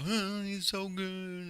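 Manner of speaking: high, slow mocking gush; sarcastic high fanboy impression; mocking Dana. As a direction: mimic him fawning, drawn out and soft. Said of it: "old Daddy Dana trying to".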